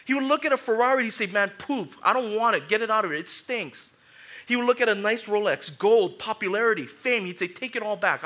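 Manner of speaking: loud, high, driving preacher voice; fervent pulpit preaching; full-force preaching. Acted. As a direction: preach it hard to the crowd.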